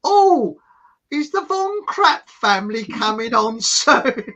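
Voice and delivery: high, loud, slow hammed-up old lady; loud lady in cinema. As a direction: ham up a loud posh cinema-goer asking. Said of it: "she starts speaking loudly".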